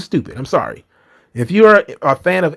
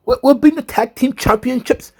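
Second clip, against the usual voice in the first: high pleading Nikki voice; imitating Nikki; 4 semitones higher than usual. Host mocking Nikki begging Rhea, higher and pleading. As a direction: beg sweetly, higher. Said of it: "and Nikki's like".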